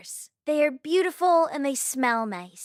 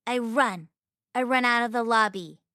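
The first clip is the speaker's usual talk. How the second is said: plain reading voice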